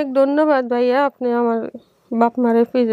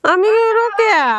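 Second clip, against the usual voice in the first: louder, higher for a deaf ear; to someone hard of hearing; 9 semitones higher, 3 dB louder than usual. Real, not acted. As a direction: call it out clearly. Telling deaf mother her name.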